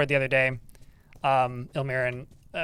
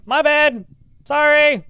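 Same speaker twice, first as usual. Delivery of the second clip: much higher, louder sorry called out; shouted apology; 11 semitones higher, 9 dB louder, slower than usual. Real, not acted. Player shouting sorry to an ally just hit, loud apology. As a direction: call out quickly to make amends.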